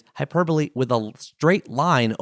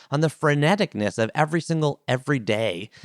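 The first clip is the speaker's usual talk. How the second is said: normal talking voice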